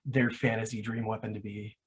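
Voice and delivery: ordinary talk, nothing marked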